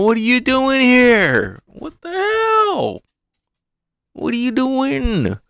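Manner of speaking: hammed-up high little girl voice. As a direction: squeal it like a small girl. Voicing Otama.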